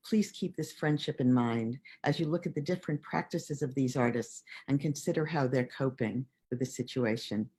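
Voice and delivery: ordinary talk, nothing marked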